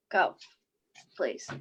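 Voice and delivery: low, soft plea; hushed little please; pleading. Acted. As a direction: beg quietly. Pleading with child to sleep.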